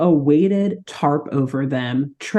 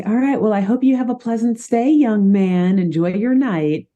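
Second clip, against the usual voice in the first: put-on sweet old lady voice; 6 semitones higher than usual. Widow Wilson greeting guest.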